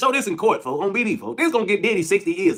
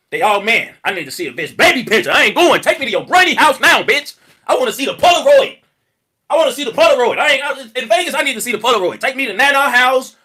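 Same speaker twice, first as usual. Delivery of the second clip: loud insistent demands, hammering each line; loud demanding rant; 6 dB louder than usual. Retold or imitated. To the woman he met in Vegas, a loud insistent demand. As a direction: bark it out, demanding proof now. Acted demand to see baby picture.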